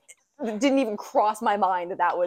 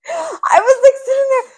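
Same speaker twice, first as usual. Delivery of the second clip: high, loud, laughing almost to tears; crying with laughter; 10 dB louder, slower than usual. Real, not acted. Cohost tricked by her friend, crying with laughter. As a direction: laugh until it cracks into a sob.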